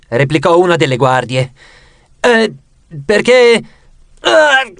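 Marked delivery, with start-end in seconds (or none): 2.2-4.7 high, halting fake groans; feigned seasickness. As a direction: act queasy, breaking into moans. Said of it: "simulare il più possibile un'espressione da mal di mare". (finto mal di mare)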